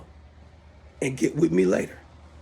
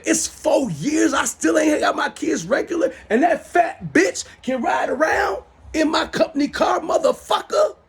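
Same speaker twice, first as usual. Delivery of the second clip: angry shout; 6 semitones higher than usual. Real, not acted. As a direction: yell it out, fed up and furious. Ranting about ex-wife in car.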